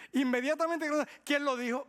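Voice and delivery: loud, high "who said it?"; mock-offended demand for a name; defensive outburst. Acted defensive reaction.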